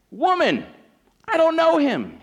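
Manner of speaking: loud, high-pitched protest; acted outburst of denial; emphatic denial. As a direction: cry out an indignant denial. Peter's denial to servant, acted.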